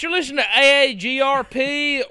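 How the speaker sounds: radio announcer. Pretend.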